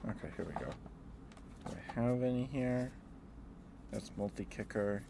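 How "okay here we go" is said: ordinary delivery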